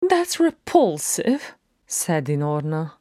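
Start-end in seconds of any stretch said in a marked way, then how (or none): 0.1-1.5 high, drawn-out voice of revulsion; disgusted. Acted. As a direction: recoil in a slow, high-pitched voice. Dinorna disgusted by the joke.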